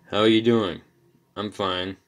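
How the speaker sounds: loud, unexcited sample dialogue; boring small talk. Shown as a demonstration. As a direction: trade greetings with no lift in pitch. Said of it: "that's boring as hell".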